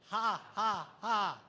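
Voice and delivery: loud open ha ha ha; leading the standing crowd in laughter yoga, loud hearty ha. Shown as a demonstration. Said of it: "we're gonna start down here".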